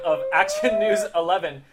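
ordinary talk

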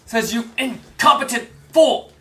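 trapped man yelling at the guard through the door; furious yell. Acted. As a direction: snap back at him in a rage. NPCs arguing through door.

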